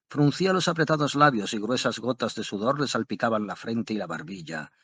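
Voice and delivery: usual speaking voice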